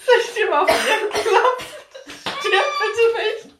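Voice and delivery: high shrieking laughter; shocked laughing. Real, not acted. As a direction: laugh in alarm at a choking friend. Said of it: "Hab ich grad ein bisschen verschluckt".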